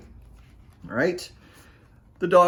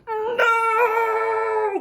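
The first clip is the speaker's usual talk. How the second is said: long, very high wailing cry; boy's shout. Shown as a demonstration. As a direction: shout it like a dismayed boy. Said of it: "Let's hear your best no".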